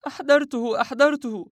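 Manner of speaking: very high, loud, agitated woman's cry; panting woman's voice. Acted. Howaida back on the phone with the honey, breathless. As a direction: pitch way up as a flustered woman reporting back. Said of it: "لاهثة وهي تردد".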